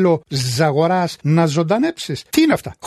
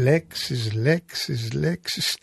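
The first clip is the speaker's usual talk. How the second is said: fading repetition; 3 semitones lower, 7 dB quieter, much slower than usual. Acted. Repeated words fading away.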